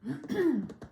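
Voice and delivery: a real chesty cough. Real, not acted. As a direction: cough hard once. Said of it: "Excuse me, I'm gonna cough".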